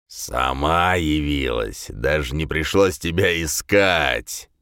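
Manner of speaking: slow, low-pitched menacing demon; sinister demon voice. A narrator's reading. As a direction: drag it out like a gloating fiend. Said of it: "зловещий голос демонической твари".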